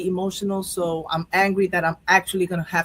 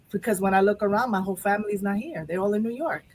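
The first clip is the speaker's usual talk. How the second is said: ordinary delivery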